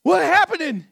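high, loud cry of alarm, acted out; imitated yell. Preacher acts out Red yelling to Joe in church, loud yell. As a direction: act out yelling to a friend in panic. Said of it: "he yells at the top of his lungs".

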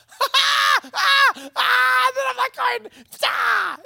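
high, loud excited yells; excited yelling. Retold or imitated. Hunter yells in excitement as the rabbits come running. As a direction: whoop at the rabbits coming.

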